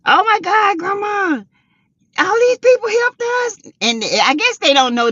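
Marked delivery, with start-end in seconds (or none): 0.1-3.6 grandkids excited. Retold or imitated. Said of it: "They was like".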